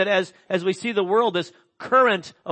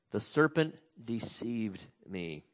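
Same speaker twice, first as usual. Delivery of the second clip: "The serpent deceived me" repeated slower and softer, letting it sink in; 6 semitones lower, 8 dB quieter, slower than usual. Shown as a demonstration. Preacher repeating Eve's excuse to let it land, slow and soft.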